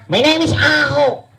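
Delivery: put-on high-pitched puppet child voice; puppet kid voice. Ventriloquist's puppet boy gawks at the crowd, high-pitched.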